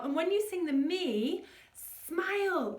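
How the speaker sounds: usual speaking voice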